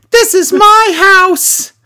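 high, loud, drawn-out scream; Paige. Retold or imitated. Ciampa turns into a fellow wrestler, high screamed impression. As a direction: shriek it slowly as a mock wrestler's war cry. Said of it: "Ciampa turns into Paige, and he's like".

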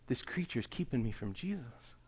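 soft, subdued quote; hushed retold realisation. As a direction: confide the discovery quietly.

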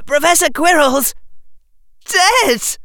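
high, anguished wail. Acted. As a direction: wail out the grief. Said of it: "wailed Harry Potter".

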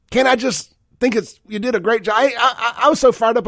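high, exasperated complaint. Real, not acted. Radio host venting at Deion, high and exasperated. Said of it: "I only make TikToks when I'm mad".

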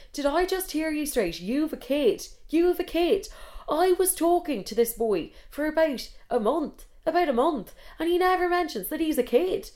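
high-pitched, incredulous outrage; shocked indignation. Retold or imitated. Storyteller reacting to date's secret child, outraged. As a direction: spell out each point in scandalised disbelief.

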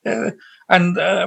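normal talking voice

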